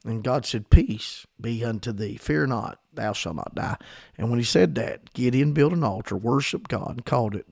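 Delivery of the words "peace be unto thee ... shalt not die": soft, low and calming, fading away; gentle reassurance. Retold or imitated. God softly reassuring the terrified Gideon, quiet and low. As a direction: settle a frightened man.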